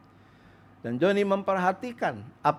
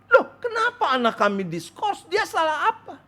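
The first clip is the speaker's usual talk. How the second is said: indignant parents; 12 semitones higher than usual. Acted. Parents protest to the dean.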